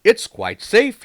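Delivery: ordinary delivery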